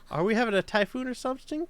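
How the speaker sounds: ordinary delivery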